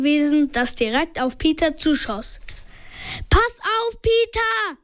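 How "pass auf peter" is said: high, urgent called warning; yelled warning. A narrator's reading. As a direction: shout a warning in storytelling voice. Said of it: "brüllte er".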